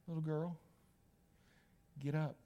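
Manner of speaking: low, hushed and unhurried; soft, tender command. Acted. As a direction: gently wake a child with a pause. Jesus quietly waking the dead girl.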